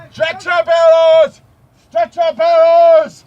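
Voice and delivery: loud urgent call; shouting. Acted. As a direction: yell for the medics. Calling for medics.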